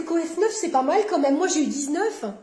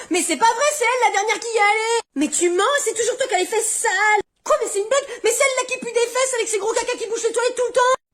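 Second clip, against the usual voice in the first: put-on whiny child; 8 semitones higher, faster than usual. Kids squabbling over dirty toilets.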